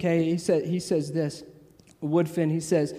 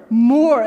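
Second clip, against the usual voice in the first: "more" hit hard and loud; preacher's loud emphasis on "more"; emphatic more; 5 semitones higher, 9 dB louder than usual. Real, not acted. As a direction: punch "more" with force. Preacher punches the word.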